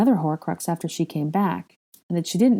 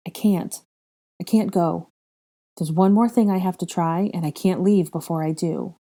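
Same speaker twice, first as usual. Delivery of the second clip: plain reading voice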